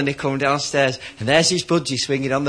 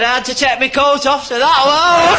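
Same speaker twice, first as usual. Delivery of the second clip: higher, louder cocky boast; proud punchline; 9 semitones higher, 6 dB louder than usual. Acted. Budgie boasting to the owner about the eagle, loud and cocky. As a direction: deliver the topper with swagger.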